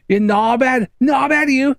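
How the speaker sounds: high, furious Scouse rant; high, raging Liverpool accent; angry Scouse accent. Retold or imitated. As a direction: let rip in full Scouse at the lot boy. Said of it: "Why am I going really Scouse?".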